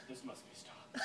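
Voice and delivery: hushed, soft heroic resolve; tiny hero voice. Acted. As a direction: play a tiny hero under your breath. Acts tiny heroic insect's line.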